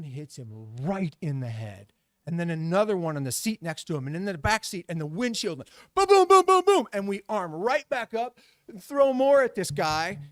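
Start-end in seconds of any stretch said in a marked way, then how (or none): 5.9-6.9 loud, high run of booms; rapid booming impacts. Retold or imitated. Balloons pelting the windshield, loud imitated booms.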